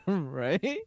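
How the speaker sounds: giggling agreement; laughing. Real, not acted. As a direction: laugh out the word. Laughing fit continues.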